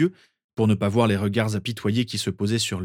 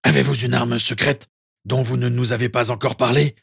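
louder, mocking question; sarcastic; 3 semitones higher, 6 dB louder than usual. A narrator's reading. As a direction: needle him with mock curiosity. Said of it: "ajouta-t-il d'une voix sarcastique". (ton sarcastique et plus fort)

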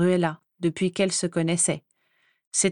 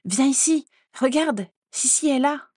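higher-pitched, eager call; 6 semitones higher than usual. A narrator's reading. Daisy calls her mother to see Sissi, calling out. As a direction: beckon mother over eagerly. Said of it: "appela Daisy".

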